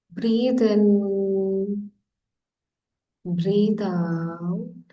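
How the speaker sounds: slow, drawn-out meditation cues; guided meditation. Real, not acted. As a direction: stretch each breathing cue, with long pauses. Leads a guided breathing meditation.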